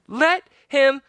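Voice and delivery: put-on high, loud, slow girl's demand; girl demanding the pirate release Jack; fierce demand. A narrator's reading. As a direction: order the pirate firmly. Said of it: "she said".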